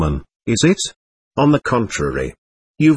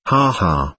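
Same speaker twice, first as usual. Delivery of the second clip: low chuckle; 6 semitones lower than usual. A narrator's reading. Nikolay laughing along with Mitya, low chuckle. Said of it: "listened, and laughed too".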